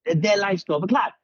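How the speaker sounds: high-pitched, stern mock Russian professor; Russian professor interrogation. Mock Russian professor refusing a student credit, stern and high. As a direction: imitate an interrogator laying down the rules. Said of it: "the Russian spy in him came out".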